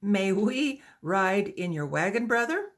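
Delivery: calling up to a stranger. A narrator's reading. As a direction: call across to him, high. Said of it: "she called to him".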